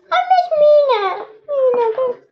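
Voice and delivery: high slow little voice; squeaky insistence. Pretend. Little toy insisting it is important too, high and slow. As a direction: plead it high and drawn out.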